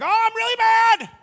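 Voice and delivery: very high, loud hammed-up squeal; mock furious Jesus. Jesus to waiting crowd, very high mock angry screech. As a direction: screech the anger, clearly fake. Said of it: "He's like".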